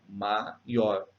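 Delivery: "maior" in two parts, ma-ior; separated syllables. Shown as a demonstration. Teacher repeating the hiatus, syllables kept apart. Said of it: "A gente lê separado".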